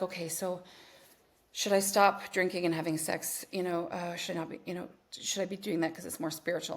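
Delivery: softly imitated unsure questioner; hesitant client. Client asking spiritual coach for permission, imitated hesitantly. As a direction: ask permission haltingly and quietly.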